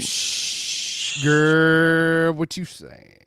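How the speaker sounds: put-on sassy hushed "Shh" then a teasing "girl". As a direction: brush it off like a sassy friend. Playful denial to co-host.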